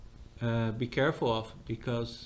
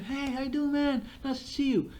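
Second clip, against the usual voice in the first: much higher and beaming, like meeting a buddy; cheerful greeting; 11 semitones higher than usual. Retold or imitated. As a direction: greet him as if thrilled to see him. Friendly AI greeting imitated.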